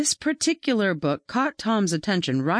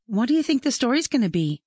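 put-on higher, quick girlish question, only lightly whispered; hushed question; 6 semitones higher, much faster than usual. A narrator's reading. Katie asking her friend before the story starts, lightly mock-whispered. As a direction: ask it fast and higher, like an eager aside. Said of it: "she whispered to him".

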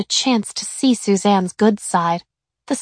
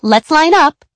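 higher, louder teacher calling out; 7 semitones higher, 6 dB louder than usual. A narrator's reading. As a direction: call the line-up cheerfully. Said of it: "she said finally".

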